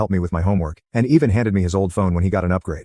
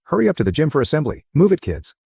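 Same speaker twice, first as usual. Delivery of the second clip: bellowing; 7 semitones higher than usual. A narrator's reading. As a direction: bellow it across the hallway.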